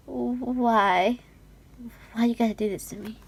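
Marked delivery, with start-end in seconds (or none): none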